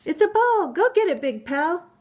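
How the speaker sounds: playful book characters. Acted. Mother reading a picture book to her child, lively character voices. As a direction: read the animal friends' squabble with bounce.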